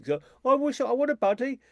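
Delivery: high, put-on whining voice; mock him pining for a buddy; whiny imitation of Lawrence. Author imitating lonely Lawrence, high whiny mock voice. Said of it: "He's always saying that".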